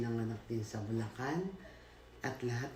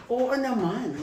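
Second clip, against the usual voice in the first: higher and louder saleslady voice; imitating the saleslady; 11 semitones higher, 10 dB louder, slower than usual. Saleslady confirming to the girl that the man is the boss. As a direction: chirp it like a gossiping salesgirl. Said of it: "Sabi naman ng mga sales lady".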